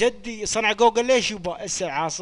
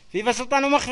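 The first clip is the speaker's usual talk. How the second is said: raised and higher, calling out فيفا سلطان هو مخفي; louder dictation; 6 semitones higher, 5 dB louder, faster than usual. Shown as a demonstration. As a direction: call the phrase out so everyone hears. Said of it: "اكتبوا". (إملاء بصوت عالٍ ومرتفع)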